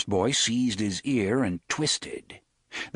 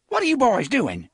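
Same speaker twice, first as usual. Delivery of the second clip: high, loud scolding woman; sharp call; 13 semitones higher, 6 dB louder than usual. Acted. Woman catching the boys, sharp loud call. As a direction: snap at the boys from afar. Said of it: "a woman's voice called sharply".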